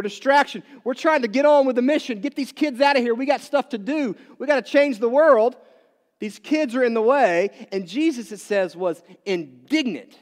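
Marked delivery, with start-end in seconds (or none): 0.8-7.5 raised, pushy voice of busy disciples; impatient disciples. Retold or imitated. Disciples brushing the children aside, impatient and raised. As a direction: brush the kids aside, we've got work. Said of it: "They were a hindrance".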